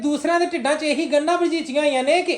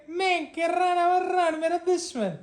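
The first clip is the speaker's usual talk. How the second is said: high, slow, soft mock whimper; mimicking tearful mother; 6 semitones higher, 6 dB quieter, slower than usual. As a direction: drag out a teasing imitation of a weepy mother. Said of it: "ਜ਼ਰਾ ਤੇ ਜਿਹੜਾ ਨਾ ਰੋਈ ਜਾਂਦੀ". (ਰੋਂਦੀ ਮਾਂ ਦੀ ਖਿੱਚਵੀਂ ਨਕਲ)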